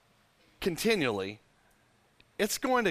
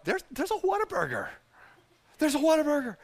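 craving; 8 semitones higher than usual. Retold or imitated. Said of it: "I'm like".

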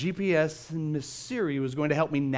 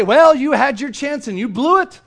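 mock scolding; 7 semitones higher, 9 dB louder than usual. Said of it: "Siri didn't say".

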